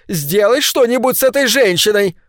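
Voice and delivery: высоко и громко, с отчаянием; требуй, почти срываясь; отчаянная мольба. A narrator's reading. Мать требует убрать пьяную. (мать кричит: высокий отчаянный крик)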